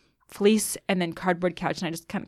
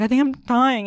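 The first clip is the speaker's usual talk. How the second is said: retold panic; 5 semitones higher, 5 dB louder than usual. Waking sister, retold panic.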